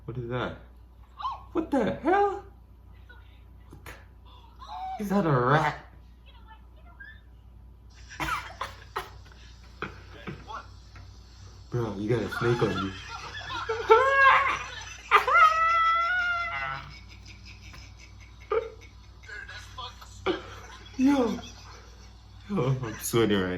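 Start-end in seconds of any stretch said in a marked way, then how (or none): 1.5-5.8 high, loud startled cry; shocked. Real, not acted. Startled by video. 7.9-11.7 high breathless wheezing laugh; shocked laughter. Real, not acted. Reacting to snake video. 13.0-20.9 high screaming laughter; scream-laugh in disbelief; shocked laughter. Real, not acted. Reacting to snake video.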